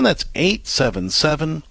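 normal talking voice

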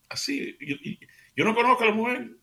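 sudden high astonished outburst; surprised. Real, not acted. Narrator to radio family, amazed at the stranger. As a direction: voice jumps up in disbelief. Stunned that a stranger offers help.

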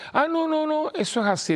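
put-on high, breezy young boy's voice; altar boy voice. Altar boy answers the priest about torn jeans, high kid voice. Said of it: "era uno de los monaguillos".

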